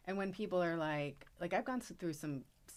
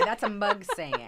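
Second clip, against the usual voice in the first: louder, laughing, slow dry put-down; mock friend quip; 4 semitones higher, 9 dB louder, slower than usual. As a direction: tease it out with a laugh. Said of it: "Joanna would say".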